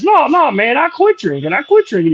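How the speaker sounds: high-pitched earnest protest; high voice, dead serious; Beetlejuice. Retold or imitated. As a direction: imitate a squeaky, insistent denial. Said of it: "you remember Beetlejuice from Howard Stern".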